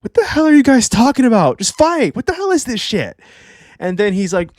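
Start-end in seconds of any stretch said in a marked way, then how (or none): 0.0-3.2 very high-pitched mock exasperation; exasperated Loki. Reviewer voicing Loki fed up with the rambling, exasperated. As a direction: squeal it in comic disbelief. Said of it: "And so Loki's like".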